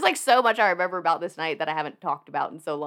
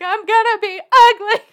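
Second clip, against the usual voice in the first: much higher and louder, laughing through it; laughing mock dismay; 14 semitones higher, 12 dB louder than usual. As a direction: wail it through laughter like a vain teen. Retold vanity, laughing.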